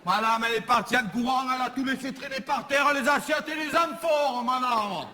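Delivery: voix de commère très aiguë et rapide; commère qui rapporte. Acted. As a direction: cafte à la voisine d'une voix perchée. (commère marseillaise, voix très aiguë)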